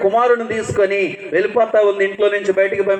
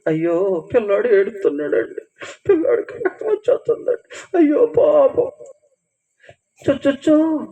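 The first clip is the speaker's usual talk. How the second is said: mock pity. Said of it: "అని కుక్కలు పిలిచేస్తున్నారు".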